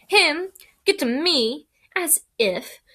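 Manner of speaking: high loud scoff. Acted. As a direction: brush it off with scorn. Ron scoffing at the idea.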